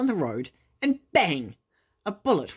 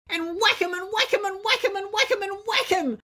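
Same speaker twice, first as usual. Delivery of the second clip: high, gleeful chant of whacks; excited cry. A narrator's reading. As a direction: hop about shouting with battle glee. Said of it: "cried Toad".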